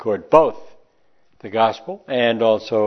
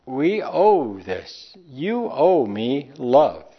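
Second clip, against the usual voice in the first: slow emphatic; 2 semitones higher, much slower than usual. Real, not acted. Preacher pressing the debt of love.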